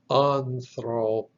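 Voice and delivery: "anthropos" said slowly, syllable by syllable; pronunciation demo. Shown as a demonstration. Teacher shows how the Greek word is pronounced, slow and measured. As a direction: sound out "anthropos" slowly, parting off the last syllable.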